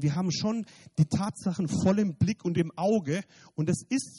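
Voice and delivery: fervent, loud testimony preaching. Acted. Preacher tells the church his healing testimony, fervent and loud. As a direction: preach it to the congregation at full force.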